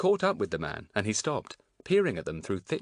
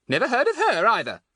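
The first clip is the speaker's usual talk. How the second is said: loud, high-pitched dismissal; more suspicious; 8 dB louder than usual. A narrator's reading. Thin woman dismissing the man's sister, suspicious and irritated. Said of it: "looking more suspicious than ever".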